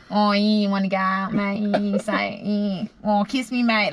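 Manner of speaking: broad mock Australian come-on; hammed-up Australian accent; flirting Aussie. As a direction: imitate an Australian hitting on someone. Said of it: "getting hit on by an Aussie".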